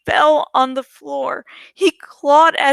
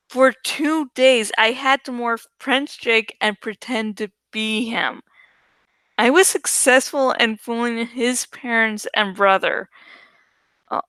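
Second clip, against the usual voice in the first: usual speaking voice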